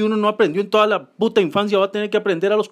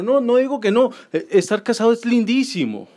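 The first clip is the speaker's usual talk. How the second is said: ordinary talk, nothing marked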